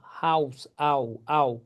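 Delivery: "हाउस" then slow "आओ, आओ" glide; pronunciation demo. Shown as a demonstration. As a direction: break the diphthong apart. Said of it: "in fact ए यू पढ़ रहे हो". ("आओ, आओ" को अलग-अलग खींचकर)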